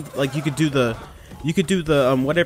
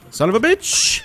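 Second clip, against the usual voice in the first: a much higher, louder cursing shout; angry outburst; 4 dB louder than usual. Real, not acted. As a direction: explode at the game in frustration. Dies in game, curses.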